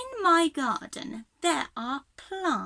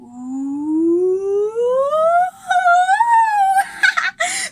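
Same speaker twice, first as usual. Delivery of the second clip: long rising glide; 13 semitones higher, 10 dB louder than usual. Shown as a demonstration. Teacher makes the growing noise, a long rising glide. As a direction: stretch the growing sound up slowly. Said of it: "make the noise too".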